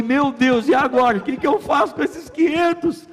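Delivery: loud, high-pitched comic alarm; acted-out flustered outcry; retold panic. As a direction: exclaim in hammed-up dismay, pitch soaring. Caught by landlord holding the money.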